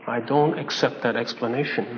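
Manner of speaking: low, soft, measured refusal; the Lord speaking. Acted. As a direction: quote the Lord quietly, pitched low. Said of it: "Here the Lord says".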